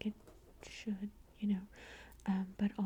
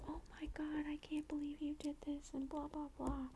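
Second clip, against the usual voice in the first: high, soft mock panic; retold freak-out; 6 semitones higher, 5 dB quieter than usual. Neighbor can't believe he did this, mock freak-out. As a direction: imitate her freaking out in a small high voice. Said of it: "You're, like, freaking out".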